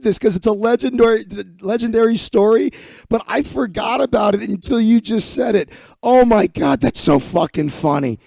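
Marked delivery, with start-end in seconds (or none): none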